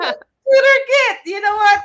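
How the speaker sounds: high, loud and laughing; excited outburst. Real, not acted. Teacher delighted with students' answers. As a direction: burst out with delight.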